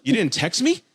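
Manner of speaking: high-pitched mock girl voice, confused; imitating surprised date. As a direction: playfully voice her shock, pitched well up. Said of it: "And she goes".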